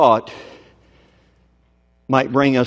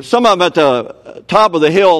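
usual speaking voice

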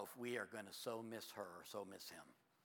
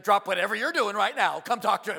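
much higher and much louder demand; insistent command; 9 semitones higher, 19 dB louder than usual. Retold or imitated. Ann demanding the pastor come now, loud insistent. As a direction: order him to come right now. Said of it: "She's like".

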